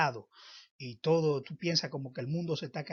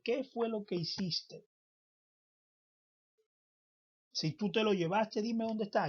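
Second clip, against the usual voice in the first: anguished pleading; 6 semitones higher, 5 dB louder than usual. Acted. Mary pleading with the gardener.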